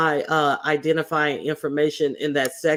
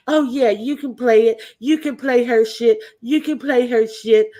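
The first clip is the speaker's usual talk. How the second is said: mocking quote; 7 semitones higher, 4 dB louder than usual. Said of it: "had the audacity to say".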